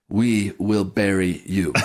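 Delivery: "We will bury you" spelled out slowly, word by word; slow ominous threat. Retold or imitated. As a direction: let the threat land slowly and darkly. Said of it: "I'm glad you're doing all the Khrushchev quotes".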